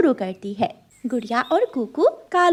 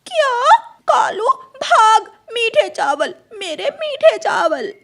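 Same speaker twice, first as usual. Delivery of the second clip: high, loud panicked cry; shocked wail; 11 semitones higher, 6 dB louder than usual. Acted. Crow realising she was tricked, panicked wail. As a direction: shriek for the lost rice.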